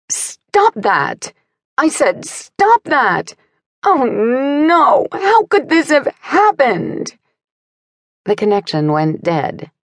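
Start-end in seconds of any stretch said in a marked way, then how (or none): 0.1-7.2 high-pitched, agitated cry climbing higher; agitated yelling. A narrator's reading. Caller yelling down the phone to Sophie, agitated. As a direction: yell in a panic, voice pitched way up. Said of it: "an agitated voice yelling".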